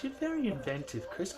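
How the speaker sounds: high, put-on motherly voice; playful high mum voice praising her son; imitating mum. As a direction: lift into a sweet high mum impression. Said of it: "she goes".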